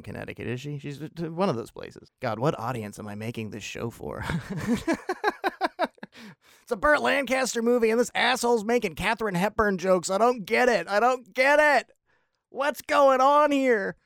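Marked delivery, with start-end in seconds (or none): 4.3-6.3 high laugh. Real, not acted. Laughing at his own joke. 6.6-14.0 mock baffled viewer.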